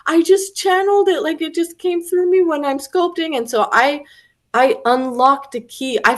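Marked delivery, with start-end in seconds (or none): 0.1-3.4 high, pleading mock-tearful voice; acting out her overwhelmed confession; imitated tearful overwhelm. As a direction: retell it as if through sobs. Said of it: "she just started sobbing".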